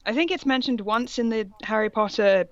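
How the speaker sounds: usual speaking voice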